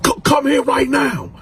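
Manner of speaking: high, loud bellowing order; shouted order. Retold or imitated. Inmate yelling across the pod for his cellmate. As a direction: yell him over like a furious cellmate.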